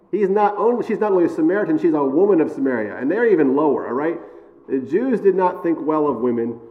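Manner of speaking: ordinary delivery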